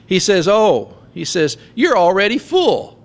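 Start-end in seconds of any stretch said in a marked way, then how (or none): 0.5-1.0 high, mocking oh; a scoffing, knowing oh; sarcastic oh. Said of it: "And Paul gets sarcastic". 1.8-3.0 high mocking praise; sarcastic. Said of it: "And Paul gets sarcastic".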